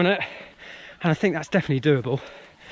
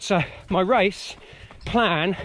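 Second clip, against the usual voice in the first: ordinary delivery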